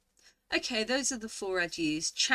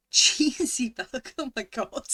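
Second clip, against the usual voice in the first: laughing at the word; 2 semitones higher, 7 dB louder than usual. Real, not acted. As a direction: laugh it out, slow and amused. Amused by odd bug name.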